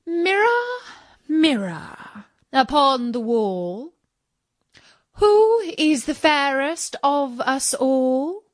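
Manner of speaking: a lightly voiced queen calling her mirror. A narrator's reading. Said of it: "went to her mirror and said".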